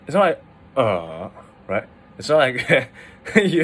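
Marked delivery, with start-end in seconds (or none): 0.8-1.3 "uh" drawn out, dipping then rising; exaggerated third tone. Shown as a demonstration. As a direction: textbook down-up swoop on "uh". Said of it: "like, the third tone".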